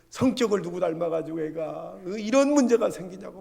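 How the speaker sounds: acted nagging, loud reproach; angry mother. Mother blaming the crying child's temperament, angry scolding. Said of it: "엄마도 막 화가 나는 거예요".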